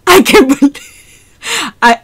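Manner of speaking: ordinary talk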